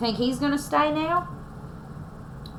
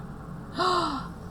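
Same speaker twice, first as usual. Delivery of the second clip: gasp; 7 semitones higher, 7 dB quieter than usual. Acted. After bear sneezes.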